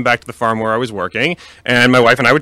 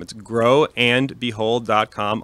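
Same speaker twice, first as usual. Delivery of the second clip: slow and clear; 4 dB quieter, much slower than usual. Shown as a demonstration. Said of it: "spelling out the web address".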